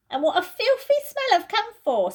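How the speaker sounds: Edmund's voice. Acted. Edmund complains to the others about the camphor, disgusted. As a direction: wrinkle your nose at the smell.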